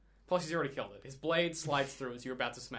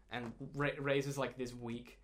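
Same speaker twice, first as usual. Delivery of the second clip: ordinary talk, nothing marked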